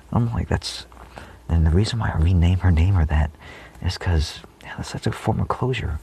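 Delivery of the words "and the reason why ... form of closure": normal talking voice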